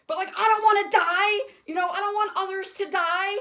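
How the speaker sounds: blurting out a genuine fear of dying; crying out in fear. Real, not acted. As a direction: voice breaking into real tears. Real fear of dying from virus.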